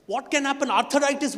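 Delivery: ordinary delivery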